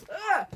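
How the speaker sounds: short high, loud squeal; excited squeal. Real, not acted. Diarist thrilled by a juicy old note, squealing. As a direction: let out a loud excited yelp.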